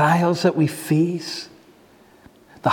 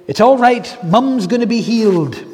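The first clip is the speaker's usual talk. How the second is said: higher and louder, bursting with hope; excited sister; 5 semitones higher, 5 dB louder than usual. Retold or imitated. Sister promising her brother their mother will heal, eager. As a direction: announce the healing with eager joy. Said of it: "so excited into the ward".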